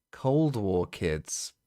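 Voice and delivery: ordinary talk, nothing marked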